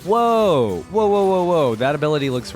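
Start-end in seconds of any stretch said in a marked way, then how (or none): none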